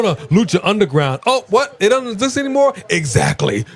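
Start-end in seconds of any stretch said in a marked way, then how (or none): 1.3-2.7 high fake astonishment; mock surprise. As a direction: act shocked, pitched up.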